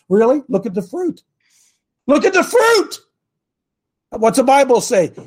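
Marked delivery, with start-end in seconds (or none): 2.1-3.0 high-pitched exasperated cry; insistent, pitched way up; emphatic exclamation. Real, not acted. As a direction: drive it home with a sharp jump in pitch. Exasperated repeat answering his critics.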